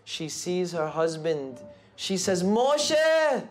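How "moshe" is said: high, loud cry of recognition; joyful cry. A narrator's reading. Wife sees her husband after thirty-five years, crying out his name joyfully. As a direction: call his name in overjoyed shock.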